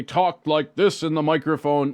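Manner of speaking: loud, over-projected mic voice; talking too loud. Shown as a demonstration. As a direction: blast it into the microphone. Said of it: "if they talk like this".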